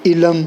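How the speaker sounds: علم as two syllables "i-lam"; mispronunciation demo. Shown as a demonstration. Teacher showing a hypothetical reading, علم said "i-lam". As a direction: say علم with an added vowel, "ilam". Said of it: "اس کو اگر ہم پڑھیں گے نا".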